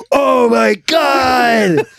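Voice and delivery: high, loud, drawn-out mock horror; shocked cry. Friends watching the gory ad, loud shocked cry. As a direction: stretch it out in aghast disbelief.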